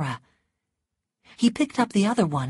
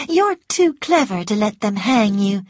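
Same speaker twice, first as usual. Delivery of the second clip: higher, louder eager woman; excited sweet talk; 5 semitones higher, 7 dB louder than usual. A narrator's reading. Scarlett flatters the jailed Rhett, sweet and excited. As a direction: flatter him in a high, excited voice. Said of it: "it was difficult to talk sweetly".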